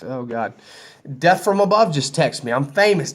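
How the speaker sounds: ordinary talk, nothing marked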